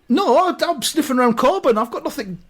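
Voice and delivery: high-pitched defensive protest; halting, worked-up rebuttal; indignant denial. Acted. Denying an accusation.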